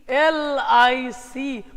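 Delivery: एल आई सी spelled out very slowly; slow letter-by-letter. Shown as a demonstration. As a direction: give each letter of एल आई सी its own beat. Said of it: "पढ़ के देखो". (एल आई सी धीरे-धीरे अक्षर-अक्षर)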